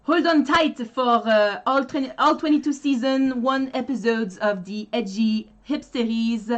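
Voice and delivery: English read with a Parisian French accent; French-from-France accent. Shown as a demonstration. Demonstrating how the French read English, French-from-France accent. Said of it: "So French accent, they're gonna".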